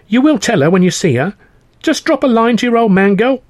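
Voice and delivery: high, loud, insistent plea; an old father's urgent parting appeal; pleading father. Acted.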